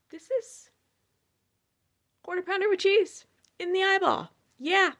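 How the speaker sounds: high, loud storyteller's punchline; emphatic exclamation. Real, not acted. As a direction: announce the absurd hit loudly. Dramatic reveal of being hit.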